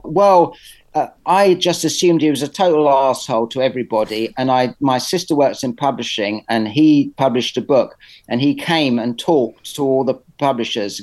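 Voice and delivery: ordinary talk, nothing marked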